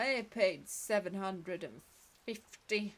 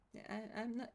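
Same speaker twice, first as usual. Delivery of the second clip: ordinary talk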